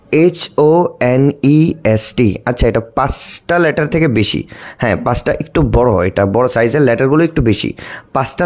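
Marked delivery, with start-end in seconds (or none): 0.1-2.4 slow spelling with a gap after each letter; measured, separated letters. Shown as a demonstration. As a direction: spell it out one letter at a time. Teacher spells out a word.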